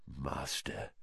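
very soft, low murmur. Acted. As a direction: breathe the word reverently. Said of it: "he murmured".

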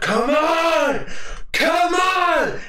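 high, crying shout; shouted taunt. Retold or imitated. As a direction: yell at the monster to come. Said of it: "He's like".

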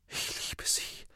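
breathless whisper. A narrator's reading. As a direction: say it on no breath at all, lovesick. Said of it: "wiederholte Ron atemlos".

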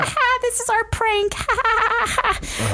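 high giggly taunting laugh; mocking laugh. Staff in the tent, high giggling taunt. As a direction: cackle it like a snotty prank.

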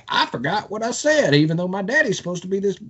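high, fast mocking whine; mocking Graham. Podcaster mocks the senator's excuse in high fast whine. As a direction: squeak out a mock excuse. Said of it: "And now he's like".

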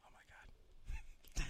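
slow breathless whisper; shocked whisper. Real, not acted. Listener stunned by Amy's news, slow shocked whisper. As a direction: let it fall out in a stunned whisper. Reacting to the weak heart news.

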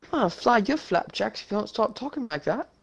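put-on British accent. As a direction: threaten playfully in a British accent. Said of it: "did you just go British".

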